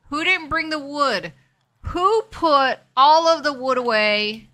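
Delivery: mock outrage at a teammate; exasperated. Real, not acted. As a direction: drag out the blame at whoever hid the wood. Frustrated at teammate hiding wood.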